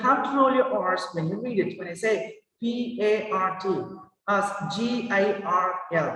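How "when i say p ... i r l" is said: ordinary talk, nothing marked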